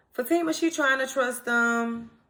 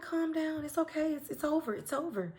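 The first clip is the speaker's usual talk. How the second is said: soothing hush; 3 semitones higher, 9 dB quieter than usual. Retold or imitated. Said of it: "she's just like".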